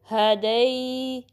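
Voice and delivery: हदई with a long gliding ai; slow recited हदई, final diphthong held; stretched ya leen. Shown as a demonstration. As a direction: draw out the end of हदई, jaw lowering. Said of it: "one point five count stretch करना".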